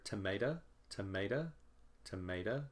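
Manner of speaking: "tomato" as tuh-MAY-tuh, ending on a weak uh; reduced last vowel. Shown as a demonstration. Teacher showing what you may hear, "tomato" ending in reduced uh. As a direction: reduce the final O of "tomato" to uh, with a lift. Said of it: "The sound may or mi-, may not change".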